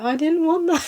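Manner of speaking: very high whiny mock sob; mock crying wail. Pregnant woman wailing at partner's wrong chocolate, mock crying whine. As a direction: wail it like a hormonal burst of tears. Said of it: "burst out crying and be like".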